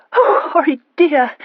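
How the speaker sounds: slow, high, loud sigh half crying, half laughing; sighing between tears and laughter. A narrator's reading. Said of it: "sighed Elizabeth, between tears and laughter".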